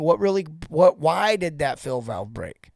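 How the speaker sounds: ordinary delivery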